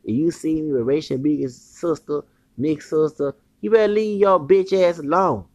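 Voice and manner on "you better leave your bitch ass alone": raised, higher threat; heated warning. Real, not acted. Man threatening a jealous rival, raised and heated. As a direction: warn him off hard.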